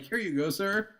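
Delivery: high, polite mock service voice; mock server. Handing the tourist his reading in a high, polite mock service voice. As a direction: hand it over like a cheerful waiter.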